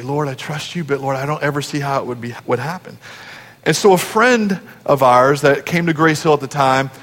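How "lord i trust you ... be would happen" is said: retold worried prayer. Retelling daughter's worried night-time prayer.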